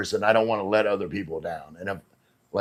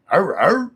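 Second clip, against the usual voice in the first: high, loud mock barking; playful dog bark; 9 semitones higher, 7 dB louder than usual. Streamer greets Jim Dog in chat, playful barking. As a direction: yap like an excited dog.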